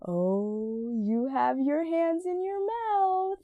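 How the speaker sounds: high, slow sing-song rising through the line; motherese. Shown as a demonstration. Demonstrating how to talk to a three-month-old, exaggerated motherese. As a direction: draw the words out, lifting high as if to a baby. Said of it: "a motherese would be like".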